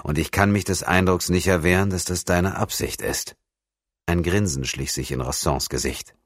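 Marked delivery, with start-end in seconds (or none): none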